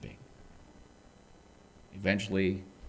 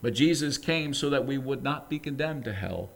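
ordinary talk, nothing marked

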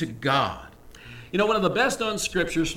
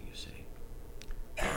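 whisper; 15 dB quieter than usual. Real, not acted. Preacher's hushed aside to congregation.

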